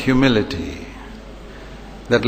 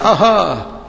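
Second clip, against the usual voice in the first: mocking, higher-pitched aha; a lifted, gloating exclamation; scornful; 4 semitones higher than usual. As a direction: pounce on someone's mistake with scorn. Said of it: "People who despise".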